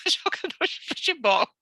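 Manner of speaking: amused laugh breaking into the words. Real, not acted. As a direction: giggle the punchline out. Laughing about son's forced future.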